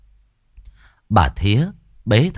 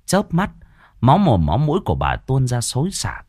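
usual speaking voice

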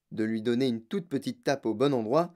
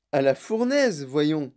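usual speaking voice

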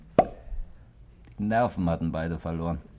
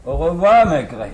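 loud tipsy farewell; 8 semitones higher, 11 dB louder than usual. Acted.

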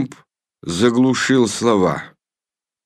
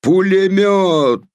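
strained shout; 8 semitones higher, slower than usual. A narrator's reading. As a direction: shout it till the throat strains. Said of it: "надсадно прокричал сержант". (надсадный крик)

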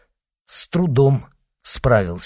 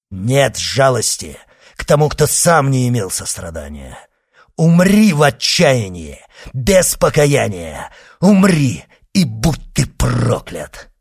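loud, raised, vengeful denunciation; cursing; 4 semitones higher, 7 dB louder than usual. Acted. Son condemns the dying executioner, cursing. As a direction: hurl the curse with full force. Avenger curses his victim.

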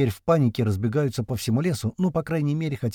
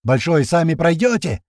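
shout; 5 semitones higher, 6 dB louder than usual. A narrator's reading. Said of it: "орёт на нашей волне".

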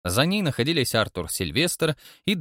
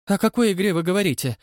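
ordinary delivery, nothing marked

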